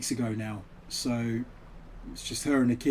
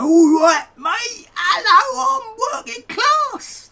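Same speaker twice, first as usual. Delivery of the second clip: much higher, shouted fake cockney, slowing at the end; mock cockney; 9 dB louder, much slower than usual. Mocking posh kids who fake cockney in London, shrill shouted mock accent. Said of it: "they spoke like this".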